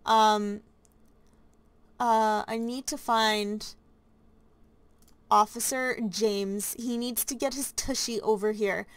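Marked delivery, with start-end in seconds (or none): none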